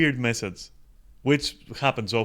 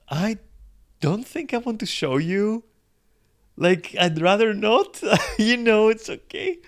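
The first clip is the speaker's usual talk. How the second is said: shy refusal; 8 semitones higher than usual. Retold or imitated. Streamer politely refusing the fan's photo request, shy timid voice. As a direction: decline meekly, as if too shy to say no. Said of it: "And I'm like".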